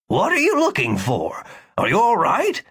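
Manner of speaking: a doctor asking, rising with concern; lightly voiced concerned doctor. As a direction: check on a man lying on the ground.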